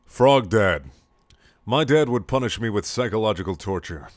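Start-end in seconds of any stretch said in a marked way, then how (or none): 0.0-0.9 slow, loud, raised intro read; title announcer voice; show intro. Acted.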